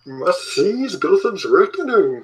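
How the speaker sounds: villain voice. Acted. Quoting Batman villain line.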